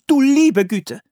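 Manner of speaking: hoher, lauter Ausruf; überraschter Ausruf. A narrator's reading. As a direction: erstaunt aufrufen. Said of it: "rief eine Frau". (eine Siedlerin ruft erstaunt)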